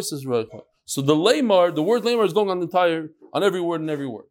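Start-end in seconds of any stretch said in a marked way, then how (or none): 0.0-0.6 the answer, lower and softer; soft echo. Shown as a demonstration. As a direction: repeat it back quietly. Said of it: "they repeat".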